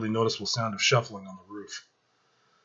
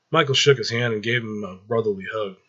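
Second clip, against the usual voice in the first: plain reading voice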